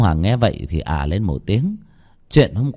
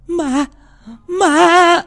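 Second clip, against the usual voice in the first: shrieking ma, ma far higher and louder; terrified scream; 7 dB louder than usual. Acted. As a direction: scream it as you see the ghost. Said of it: "Tiếng hét của bà Hương". (hét thất thanh ma, ma)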